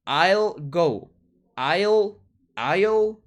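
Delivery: "I'll" said as one syllable ending in L; contraction I'll. Shown as a demonstration. Teacher drilling the contraction for learners. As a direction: say "I'll" as a single gliding sound ending in L. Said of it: "se pronuncia como una L".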